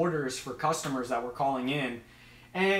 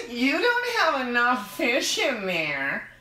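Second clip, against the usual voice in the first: high, slow mock-critic voice; put-on nitpicker, pitched way up; mocking online commenter; 6 semitones higher, slower than usual. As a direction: drag it out in a silly high whine. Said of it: "and I simply get".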